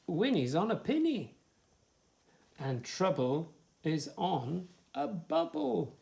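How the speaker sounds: sing-song rhyming list; reading to children. Acted. Reader lists rhymes for a child, sing-song. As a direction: bounce through the rhymes for little ones.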